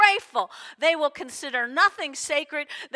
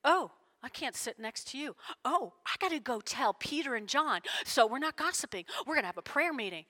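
mock judgmental gossip; 3 semitones lower, 7 dB quieter, faster than usual. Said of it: "And Jesus wasn't like".

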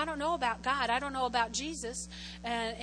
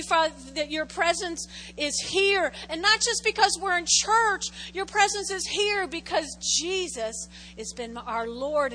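louder, raised prayer voice lifted to God; fervent prayer; 4 semitones higher, 9 dB louder than usual. Acted. Pastor praying over the church, raised fervent prayer. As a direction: pray aloud over the congregation with fervor.

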